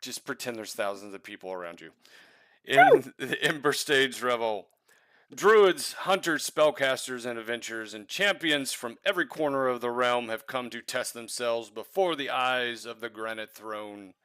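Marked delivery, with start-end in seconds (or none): 2.6-14.1 grand royal herald proclamation; herald announcement. Acted. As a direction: proclaim it to the crowd. Herald's speech continues.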